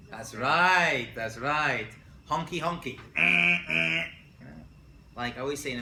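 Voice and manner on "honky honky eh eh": mock honking horn, loud and nasal-free; car horn honks. Teacher imitating Israeli drivers honking at a red light. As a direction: blare it like an impatient horn.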